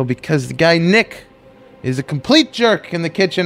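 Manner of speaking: retold public outburst against the boss; angry speech. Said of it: "I was just so angry".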